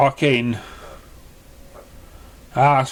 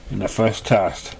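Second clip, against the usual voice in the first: ordinary delivery, nothing marked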